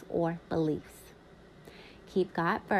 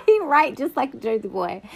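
bursting out laughing, then higher, louder words; 7 semitones higher, 11 dB louder than usual. Real, not acted. Laughing out loud while reading a friend's yearbook note. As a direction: laugh hard at the signature.

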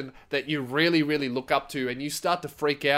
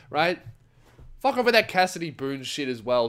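ordinary delivery

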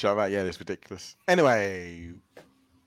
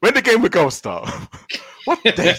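laughing; 10 semitones higher, 5 dB louder than usual. Real, not acted. Laughing at own badly worded question.

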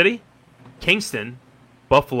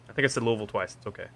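ordinary talk

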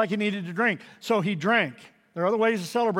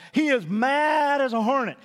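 raised, higher, louder shout on hornet; preached shout; 6 semitones higher, 5 dB louder than usual. Acted. Preacher shouting about Nabal's rage, loud. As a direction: let the anger boom out.

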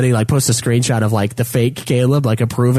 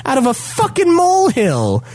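high-pitched scornful comeback; retelling the insult, pitched way up; angry retort; 12 semitones higher than usual. Retold or imitated. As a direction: put him in his place. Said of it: "So I'm like".

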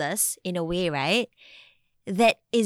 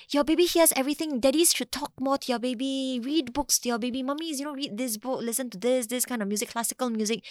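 higher, sing-out mock advice; imitated eager advisers, pitched up; advice-giver to expectant parents; 5 semitones higher than usual. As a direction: reel off well-meaning baby advice. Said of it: "we always say".

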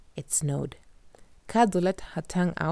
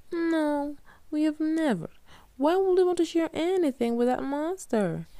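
higher indignant Katya; Katya's voice; 11 semitones higher than usual. A narrator's reading. Katya answering Kadzo, higher indignant voice. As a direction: protest with disbelief, pitched up.

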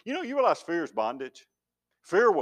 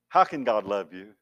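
ordinary delivery

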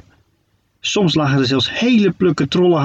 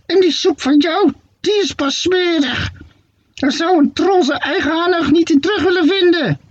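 shrill witch retort; 14 semitones higher than usual. Acted. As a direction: answer back like a squawking old witch. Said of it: "plerde Hoppakee dan terug". (zeer hoge krassende heksenstem)

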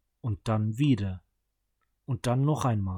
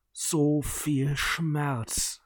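very slow, drawn-out ghoulish words; dead brother rising, speaking very slowly; undead voice; 3 semitones higher, much slower than usual. A narrator's reading. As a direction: stretch every word like a corpse speaking.

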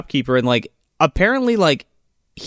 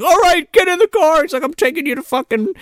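high, pushed mock command; mock gunman's order; 11 semitones higher than usual. Gunman forcing scoutmaster into the car, mock commanding. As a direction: order a captive around, hammed-up.

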